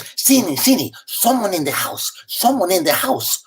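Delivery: urgent whisper-turned-plea to the host; worried Cuban mother. Retold or imitated. Cuban mother-in-law warning that someone's in the house, urgent. Loli warns of intruder.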